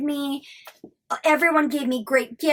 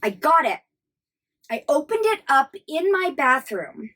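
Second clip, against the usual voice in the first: ordinary talk, nothing marked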